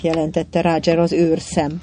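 ordinary delivery

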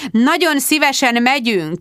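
high, emphatic snap; sudden forceful retort; snapping. A narrator's reading. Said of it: "csattant föl Sharon".

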